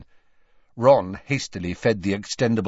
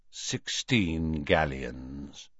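low, slow, soft answer; unimpressed shopkeeper; 5 semitones lower, 7 dB quieter, much slower than usual. A narrator's reading. Borgin quoting a price to Hermione, unimpressed. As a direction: draw it out low and slowly.

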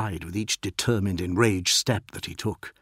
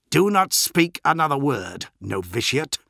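higher, louder start, dropping on the last word; stern warning; 6 semitones higher, 4 dB louder than usual. A narrator's reading. Captain silences the novitiate, stern. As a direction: silence a junior with a firm order. Captain warns Berius with levelled finger.

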